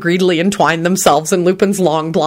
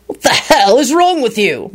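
higher, contained angry outburst; angry bark; 7 semitones higher than usual. A narrator's reading. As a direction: bark it in mild anger. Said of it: "Lupin barked out angrily".

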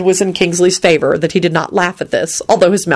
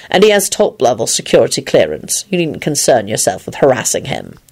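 lower, coolly courteous; polite rebuff; 4 semitones lower than usual. A narrator's reading. Lupin puts the guard in place, polite. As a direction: keep it low and civil while warning off. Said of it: "Lupin said politely to the guard".

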